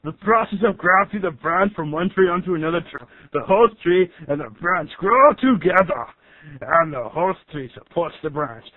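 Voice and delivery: computer narrator in an Austrian strongman voice; Arnold Schwarzenegger. Acted. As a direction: narrate science facts like an action hero. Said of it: "you guys got the rights to Arnold Schwarzenegger's voice?".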